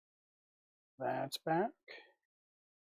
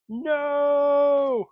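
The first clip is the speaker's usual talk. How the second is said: playful shout; 8 semitones higher, 6 dB louder than usual. Pretend. Mock protest at a revolt.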